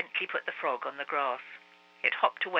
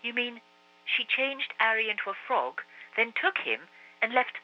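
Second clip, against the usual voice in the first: put-on astonished boy; 6 semitones higher than usual. A narrator's reading. Marcus astonished.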